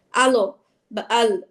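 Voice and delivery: আলো বা আল with a jolt on each ending, slow; wrong push. Shown as a demonstration. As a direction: show the pushing mistake. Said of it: "এরকম ধাক্কা দেওয়া যাবে না". (ধাক্কা দিয়ে ভুল উচ্চারণ)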